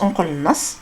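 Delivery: normal talking voice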